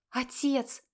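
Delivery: high, dismayed cry to father; exhaled plea. A narrator's reading. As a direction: breathe out a shocked appeal. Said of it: "выдохнула Ира".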